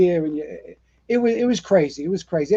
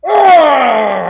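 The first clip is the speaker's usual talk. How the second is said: loud straining roar, pitched up; Superman roar; 7 semitones higher, 10 dB louder than usual. Retold or imitated. Captain ripping off a door, loud Superman roar. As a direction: heave out a heroic roar. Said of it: "like Superman, he goes".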